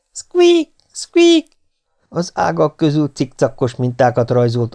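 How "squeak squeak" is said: very high, loud animal squeak; piercingly high dormouse call. A narrator's reading. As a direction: squeal it like a tiny laughing rodent. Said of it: "velünk nevettek a pelék".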